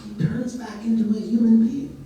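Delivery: plain reading voice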